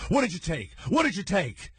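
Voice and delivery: high, urgent mock demand, the question fired twice; frantic questioning. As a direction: imitate friends pressing a panicking man. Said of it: "And they go like this".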